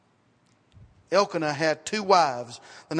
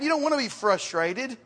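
ordinary talk, nothing marked

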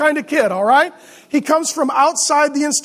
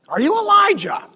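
higher-pitched mock churchgoer; Ned Flanders; 6 semitones higher than usual. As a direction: ask it as a put-on, goody-goody religious type. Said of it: "religious people sound like Ned Flanders".

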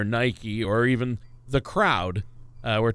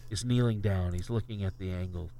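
golf commentator; 3 semitones lower than usual. Acted. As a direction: narrate the putt in a hushed TV voice. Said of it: "hushed tones of the announcers".

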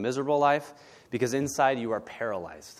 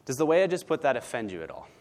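ordinary talk

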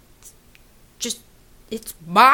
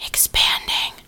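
dreamy whisper; 5 dB quieter, slower than usual. Acted. Swooning over her heart.

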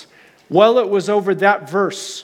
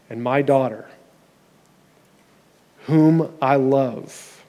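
tender blessing; 4 semitones lower, slower than usual. Acted. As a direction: hush it down and give it to each one personally. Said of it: "close your eyes with me for a moment".

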